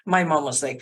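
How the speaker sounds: ordinary talk, nothing marked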